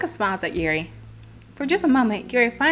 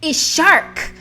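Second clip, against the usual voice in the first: mock sister teasing, higher; imitating sisters; 9 semitones higher, 6 dB louder, slower than usual. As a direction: tease her for saying shirk. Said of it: "my sisters were laughing".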